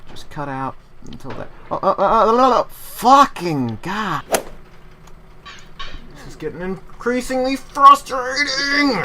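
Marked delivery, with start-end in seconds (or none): 1.7-9.0 much higher and louder, dragging slowly; real frustrated outburst. Real, not acted. Sliding back down the mountain, real loud slow anguish. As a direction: groan out the fall in real anguish. Slipping down in the game.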